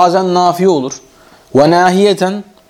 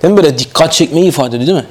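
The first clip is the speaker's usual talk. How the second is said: normal talking voice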